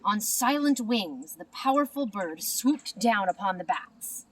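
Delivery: plain reading voice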